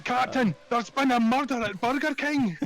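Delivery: high, slow Scottish detective voice; dramatic Scottish cop line; Scottish accent. Pretend. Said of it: "in a Scottish accent".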